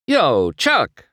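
loud, high call to a crewman. A narrator's reading. Racer calls to his pit crew, calling out. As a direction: holler over to get his attention. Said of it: "McQueen called to one of his pit crew".

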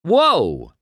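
high, alarmed whoa; sudden high yelp of protest; startled cry. A narrator's reading. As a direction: call out as if caught off guard. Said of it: "McQueen cried".

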